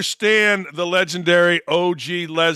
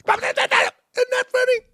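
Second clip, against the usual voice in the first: much higher, slow mocking woman's voice; mimicking the TikTok woman; slower than usual. Host mocking the TikTok woman lying in bed, high mock voice. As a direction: ham up her lazy high drawl.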